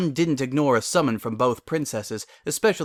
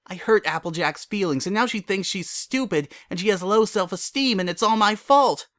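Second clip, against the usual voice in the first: panicking spiral; 8 semitones higher than usual. Acted.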